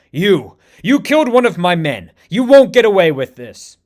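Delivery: loud, raging accusation; furious scream. Acted. M'Baku screaming at the man who killed his soldier. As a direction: bellow it at the killer. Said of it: "as M'Baku screams".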